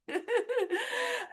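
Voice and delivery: big laugh. Real, not acted. Laugh after joke.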